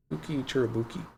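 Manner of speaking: talking through the hiccups; interrupted by a hiccup. Real, not acted. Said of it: "I got the hiccups".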